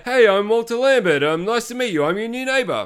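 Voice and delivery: high, loud creepy neighbor voice; Steve Buscemi neighbor. Retold or imitated. New neighbor introducing himself to Hubie, high and loud. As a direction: greet him like an odd new neighbor. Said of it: "Steve Buscemi's there".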